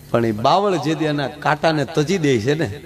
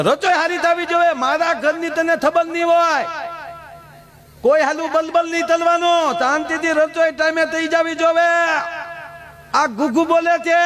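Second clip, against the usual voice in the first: high-pitched hammed-up husband laying down the law; mock stern scolding; 10 semitones higher than usual. Husband warns new wife about cooking, in a high mock-stern voice. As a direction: play-act a strict warning, voice pitched way up. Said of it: "એક જ વાર ડારો દીધો".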